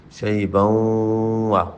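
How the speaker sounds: شیبا drawn out slowly at the verse end; stretched ending. Shown as a demonstration. Teacher reading the verse-end word شیبا. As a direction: hold شیبا long and let it fall. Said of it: "یہاں پہ بھی غنہ کریں".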